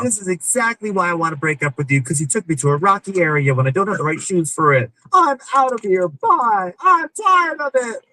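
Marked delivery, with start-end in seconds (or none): 3.7-8.0 high, huffy storm-off voice; mock huffy girlfriend. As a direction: flounce off with a slow high whine.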